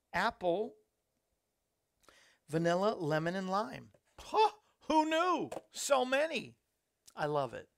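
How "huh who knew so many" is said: surprised. Real, not acted. Reading the drink's ingredient list.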